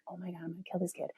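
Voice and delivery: hissed fast under the breath; furious whisper. Retold or imitated. Mom alone in the empty Jeep, a fast furious whisper. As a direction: mutter the threat to yourself, barely voiced.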